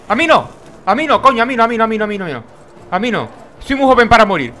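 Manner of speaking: half-joking panic as the boss hits him; hammed-up fear of dying in a game; panicked pleading. Real, not acted. Getting hit by boss.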